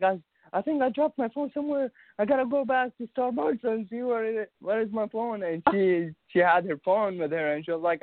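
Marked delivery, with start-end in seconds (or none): 0.0-5.4 higher-pitched, mildly flustered retelling; a touch of panic in a retold quote; retold worry. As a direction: act out realizing something is lost, voice lifted. Retold worry over lost phone.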